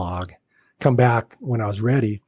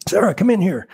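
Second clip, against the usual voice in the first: higher-pitched mock call-out; calling someone in; 7 semitones higher than usual. Agent summoning a model into his office, calling out. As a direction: call her over, imitating the agent. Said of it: "he calls her in".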